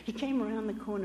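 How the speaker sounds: usual speaking voice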